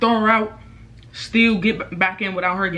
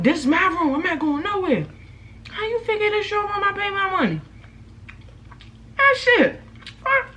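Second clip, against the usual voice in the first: very high mocking imitation of an angry woman; high, indignant mimicry; mimicked defiance; 13 semitones higher than usual. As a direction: refuse to budge in a squealed, high voice. Acts out her defiant refusal to leave.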